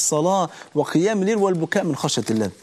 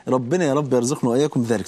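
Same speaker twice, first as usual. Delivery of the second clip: usual speaking voice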